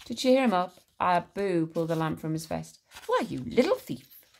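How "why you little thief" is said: high, amused mock scolding; playful, lilting reproach; chuckling. A narrator's reading. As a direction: tease it with a hint of a chuckle. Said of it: "chuckled Aladdin".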